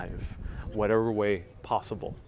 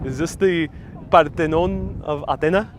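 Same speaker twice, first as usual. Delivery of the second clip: Parthenon of Athena with a Spanish accent, louder; Spanish-accented Greek; 4 semitones higher, 6 dB louder than usual. Shown as a demonstration. Showing off a new Greek accent to chat, Spanish accent. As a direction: proudly try the Spanish-Greek sound. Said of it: "Am I sounding more Greek now".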